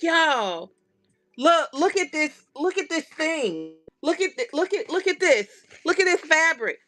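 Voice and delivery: high, bubbly excitement over fabric; excited gushing. Retold or imitated. Crafter showing off new fabric to friends, excited and high. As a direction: gush like you just got a package.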